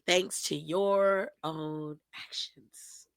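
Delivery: slow, breathy mocking whisper. Host whispering a taunt at the wrestler, slow mocking whisper. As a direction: hiss it slowly like a taunt. Gloating aside after announcing penalty.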